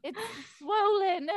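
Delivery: high, theatrical whimper; pitiful hammed-up complaint, pitched high; very dramatic. Dramatic relative showing off a stubbed toe, whimpering theatrically. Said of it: "being, like, very dramatic".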